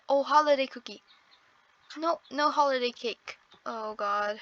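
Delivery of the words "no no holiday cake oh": usual speaking voice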